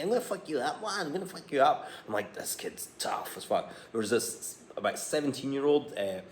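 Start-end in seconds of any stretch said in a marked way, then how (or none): 0.0-1.9 put-on high, tough Glaswegian kid accent; kid's Glaswegian accent. Boy on a feeding tube threatening in a Glaswegian accent. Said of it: "he's got this wee Glaswegian accent". 2.3-3.7 whispered, slow and amused; whispered aside. Retold or imitated. Dad's whispered aside about the tough Glaswegian boy.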